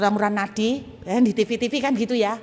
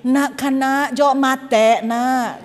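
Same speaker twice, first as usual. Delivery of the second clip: imitated Madurese accent with raised pitch; 3 semitones higher, 5 dB louder, much slower than usual. Shown as a demonstration. Preacher mimicking Madurese neighbour calling child, Madurese accent. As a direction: put on a sing-song Madurese accent. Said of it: "Kalau logatnya Madura kan gini".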